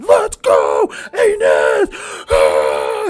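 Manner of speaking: low, very loud bellow; screaming supporter. Acted. Man cheering Inez with steam from his ears, low screaming roar. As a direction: roar it as if breathing fire.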